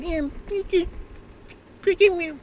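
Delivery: high, soft coaxing mother; coaxing mom. Retold or imitated. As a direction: sweet-talk the toddler into a bite. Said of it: "your mom's like".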